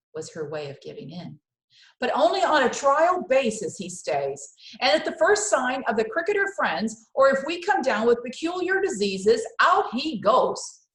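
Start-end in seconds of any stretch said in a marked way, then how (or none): 2.0-10.8 stern Mama. Acted. Mama setting conditions for the cricket, loud and stern. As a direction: set the terms firmly.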